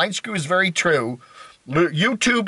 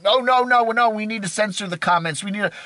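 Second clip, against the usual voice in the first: mock a fretful objector, pitched up; alarmed objection; 5 semitones higher than usual. People demanding censored comments, mock alarmed protest.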